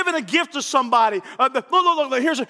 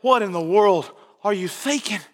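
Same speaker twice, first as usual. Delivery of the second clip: lightly voiced God, lower and puzzled; a low, gently scolding God, not too heavy; God's voice; 6 semitones lower than usual. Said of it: "And God's gonna say".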